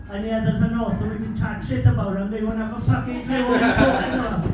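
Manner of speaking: ordinary delivery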